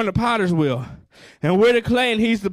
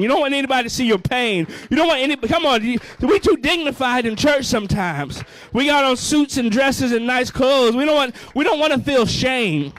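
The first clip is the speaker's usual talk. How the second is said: full-force preaching; 3 semitones higher than usual. Acted. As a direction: hammer each line home to the congregation.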